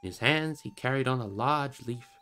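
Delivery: ordinary delivery, nothing marked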